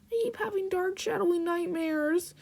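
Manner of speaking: high, forlorn clown voice; troubled clown. Acted. Clown confessing nightmares, high and forlorn. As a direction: confide it in a small high voice.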